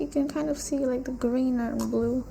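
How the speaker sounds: normal talking voice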